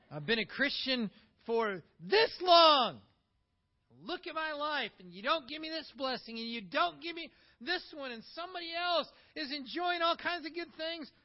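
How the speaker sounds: indignant complaint. Retold or imitated. A churchgoer whining to God about blessings, indignant. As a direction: complain like a wronged believer, voice climbing. Voicing the resentful believer.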